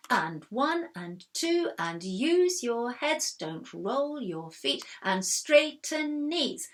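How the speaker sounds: slow, stressed corrections; ballet teacher. A narrator's reading. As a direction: drill it out with eagle eye. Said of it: "watching everyone with her eagle eye".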